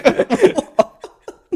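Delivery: very high, loud villain cackle; Joker laugh. Acted. Co-host attempts a film villain's laugh, high mad cackle. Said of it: "That was my Joker laugh".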